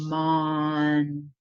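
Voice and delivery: monster drawn out slowly sound by sound; stretched out. Shown as a demonstration. Teacher modelling a stretched word for a child. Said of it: "Stretch out the sounds of monster".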